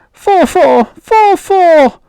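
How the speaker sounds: very high, loud mechanical chant; a robot calling out as it strikes; robot fighting. Acted. Robot counting during fight.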